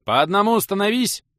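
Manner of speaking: host orders the crowd into line, shouting; shouted order. A narrator's reading. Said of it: "выкрикнул хозяин дома".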